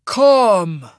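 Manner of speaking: loud, high, ringing command; commanding order. A narrator's reading. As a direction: order it with immortal authority. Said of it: "the adjudicator ordered".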